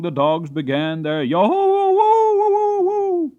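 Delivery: high, loud yo-ho-wo-wo howl; bay like a pack of hounds. Retold or imitated. Narrator mimicking the pack, high and loud. Imitating hounds baying again.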